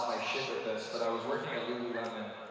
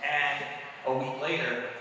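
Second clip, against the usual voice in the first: normal talking voice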